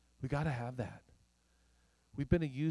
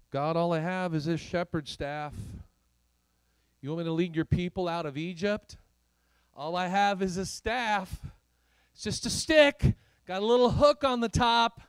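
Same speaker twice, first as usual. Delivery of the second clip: building from raised voice to high shout; mounting protest; 6 semitones higher, 9 dB louder than usual. Acted. Moses protesting he has only a staff, mounting loud protest. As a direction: plead louder and higher with each objection.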